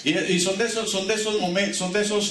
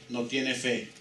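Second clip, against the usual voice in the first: vampire quote; 5 semitones lower, 6 dB quieter, slower than usual. Retold or imitated. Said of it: "¿sabe qué le dijo?".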